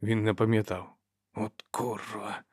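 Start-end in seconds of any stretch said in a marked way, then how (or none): 1.3-2.4 whispered curse. A narrator's reading. Seeker swears to himself before the fight, whispered. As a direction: mutter it slowly under your breath. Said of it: "прошепотів він".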